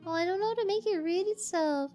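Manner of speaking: ordinary talk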